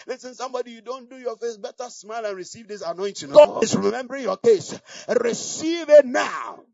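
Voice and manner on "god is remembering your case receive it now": preaching shout. Real, not acted. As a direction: shout it over the congregation. Preacher declaring to congregation.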